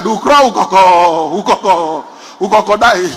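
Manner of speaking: class mimicking stammerer. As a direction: copy the teacher's stammer as a chorus. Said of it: "The whole class shouted".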